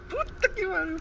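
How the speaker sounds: shocked curse. Real, not acted. Shock of the cold water.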